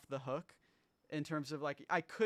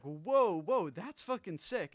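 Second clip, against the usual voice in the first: loud, awed outburst, louder; amazed exclaim; 5 semitones higher, 6 dB louder, slower than usual. Retold or imitated. Producer hearing a string player's set, loud amazed exclaim. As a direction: blurt it out, blown away. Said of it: "and I was like".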